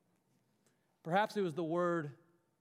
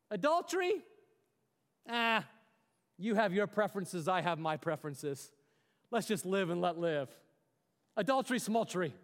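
spineless wet noodle. Acted. Mister Wet Noodle waving off adultery, mildly dismissive. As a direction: lightly act a shrugging live-and-let-live type. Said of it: "That's what Mister Wet Noodle does".

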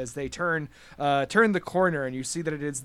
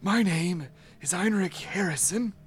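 ailing host. Acted. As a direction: greet guests as a weakened old host, pausing between phrases. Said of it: "he looks very ill".

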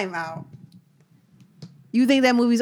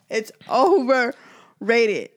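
slow teasing insistence; much slower than usual. Real, not acted. To his friend defending Hardball, slow deliberate teasing drawl. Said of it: "repeats it drawn out".

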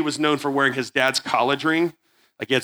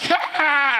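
mocking bark laugh; 11 semitones higher than usual. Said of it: "he'd be like".

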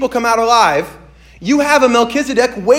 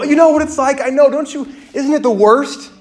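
higher and louder, eager to be understood; eager relieved reply; 7 semitones higher, 5 dB louder than usual. Retold or imitated. To a friend who went through the same, eager and relieved. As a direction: blurt it out like finally someone gets it. Retold reply to a fellow sufferer.

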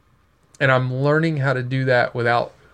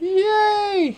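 high squealed yay; excited cheer. Real, not acted. Cheering partner's pop-up shop news.

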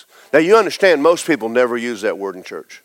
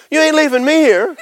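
higher, laughing protest; young man protesting; 13 semitones higher, 4 dB louder than usual. Retold or imitated. Young coworker laughing, refusing to be left behind, higher. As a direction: blurt it with a laugh, alarmed. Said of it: "He goes".

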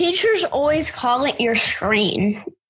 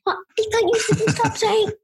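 whining; 8 semitones higher than usual. Real, not acted. Protesting the bill wording.

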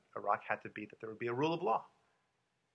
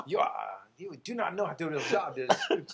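louder, higher mock French accent; 3 semitones higher, 6 dB louder than usual. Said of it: "I can do that with a French accent".

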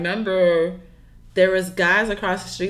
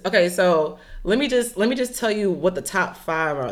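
ordinary talk, nothing marked

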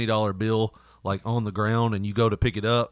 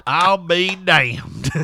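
slow, drawn-out Southern "I'll be damned"; Southern drawl; 4 semitones higher, 5 dB louder, much slower than usual. Retold or imitated. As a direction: drawl it like a burly Southern guy. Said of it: "his friend going".

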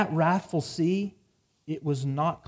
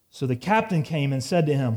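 normal talking voice

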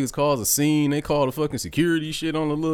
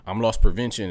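ordinary talk, nothing marked

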